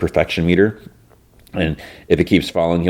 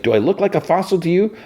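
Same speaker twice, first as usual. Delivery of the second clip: high indignant retort; sassy, much higher mock indignation; offended retort; 12 semitones higher than usual. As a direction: fire it back, insulted. [name] offended by headline.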